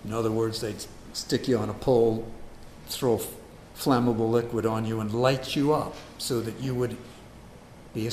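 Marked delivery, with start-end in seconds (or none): none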